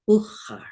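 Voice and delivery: "uchar" with the ch made in the back of the throat; throaty Hebrew "ch". Shown as a demonstration. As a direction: punch out "uchar", loud, with a throaty ch. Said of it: "Get that in the back of your throat".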